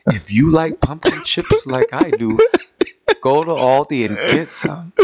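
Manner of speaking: slow mock commercial pitch; drawn-out spoof ad voice; Jersey Mike voice. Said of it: "in my Jersey Mike voice".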